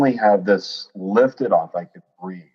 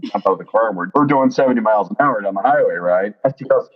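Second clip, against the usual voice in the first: ordinary talk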